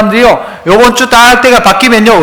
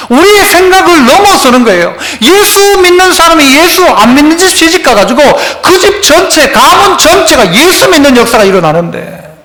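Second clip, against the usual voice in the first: raised, fervent sermon voice; preaching; 7 semitones higher than usual. Acted. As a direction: preach it out to the congregation. Preaching at full force.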